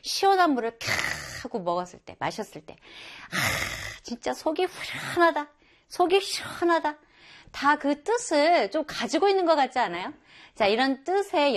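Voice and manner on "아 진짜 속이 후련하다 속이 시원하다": high, relieved exclamation; relieved after a drink. Acted. Teacher acting a drinker refreshed by cold water, relieved.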